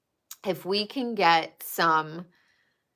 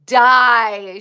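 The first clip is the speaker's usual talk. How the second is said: louder burst of delight; loud delighted reaction; I die; 8 dB louder than usual. Real, not acted. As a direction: blurt it out, cracking up. Laughing at the memory.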